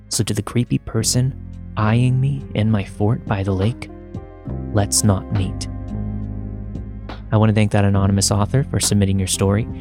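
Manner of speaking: ordinary delivery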